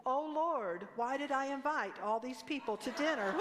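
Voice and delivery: put-on a little girl parroting her frazzled mom; exasperated mom. Child mimics stressed mom at dinner.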